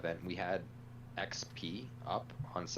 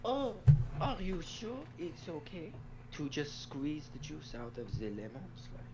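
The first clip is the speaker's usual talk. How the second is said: higher, hesitant character voice; worried, then marvelling at strangers; timid game character; 6 semitones higher, 13 dB louder than usual. Acted. Game character doubting the plan, lightly voiced.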